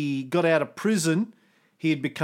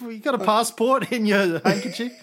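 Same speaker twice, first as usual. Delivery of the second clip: laughing while quoting; 8 semitones higher, 5 dB louder than usual. Retold or imitated. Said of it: "He goes".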